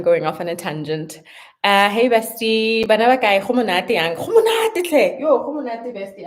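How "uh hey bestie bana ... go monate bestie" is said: excited greeting. Real, not acted. Greeting friend in Setswana.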